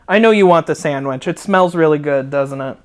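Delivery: ordinary delivery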